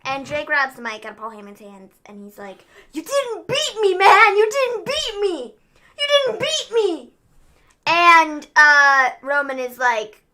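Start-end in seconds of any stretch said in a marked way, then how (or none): 2.9-7.1 high, drawn-out mock whine; wailing protest. Host acting out Jay protesting his defeat to Roman, hammed-up wail. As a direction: whine it slowly like a sore loser. Retelling wrestler's protest in acted quote.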